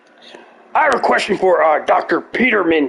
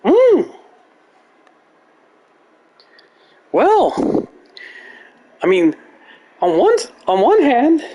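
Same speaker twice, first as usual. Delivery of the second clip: much higher, pondering aloud; Peterson impression; 10 semitones higher than usual. Peterson pondering the goat spider question. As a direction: weigh both hands like a strained professor.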